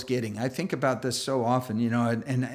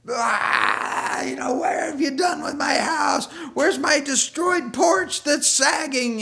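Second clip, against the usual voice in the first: mock angry rant; 12 semitones higher, 3 dB louder, slower than usual. Angry hoarder curses ministry out.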